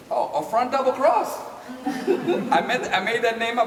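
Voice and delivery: high, loud and laughing at his own invention; excited discovery. Retold or imitated. Dancer proudly naming his new step, high and laughing. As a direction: burst out proud, laughing as the name clicks.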